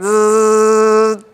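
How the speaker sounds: buzzing razor noise, high; mimicked buzzing. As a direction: imitate a shaver.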